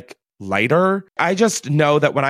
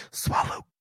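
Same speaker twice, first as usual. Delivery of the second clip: breathy whispered innuendo; 10 dB quieter than usual. Real, not acted. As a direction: whisper it suggestively.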